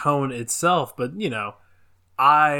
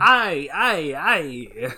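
put-on Italian accent; 3 semitones higher, much slower than usual. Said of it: "an Italian accent".

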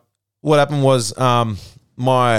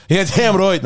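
cocky Irish brogue, higher on damn; mock Irish accent; 6 semitones higher, slower than usual. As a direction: swagger it like a fighter. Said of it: "That was a good American accent as well".

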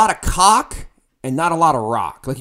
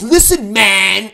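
[name] way; 4 semitones higher, 6 dB louder, much slower than usual. Retold or imitated. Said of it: "in his [name] way".

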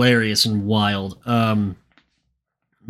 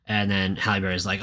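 ordinary talk, nothing marked

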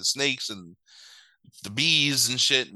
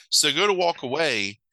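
usual speaking voice

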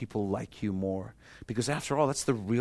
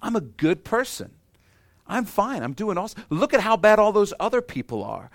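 mock-boastful, pitch climbing higher and higher; smug self-satisfied voice; 7 semitones higher, 5 dB louder than usual. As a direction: play the proud man who thinks he needs nothing, rising to a loud boast. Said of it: "you're like".

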